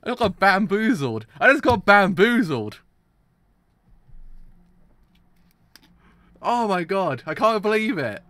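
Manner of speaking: high, loud, stunned disbelief; stunned excitement. Real, not acted. Viewer shouting in disbelief at the twist. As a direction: shout your shock, still reeling.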